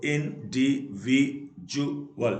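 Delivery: Individual slowly split into five beats; syllable by syllable. Shown as a demonstration. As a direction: pause between each syllable of individual. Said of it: "तो इसे पांच".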